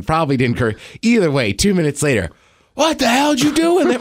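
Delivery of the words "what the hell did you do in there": high-pitched imitated indignation; hammed-up scandalized outburst, pitched way up; mock outrage. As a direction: demand it in a high, appalled voice. Acts out household's outraged reaction.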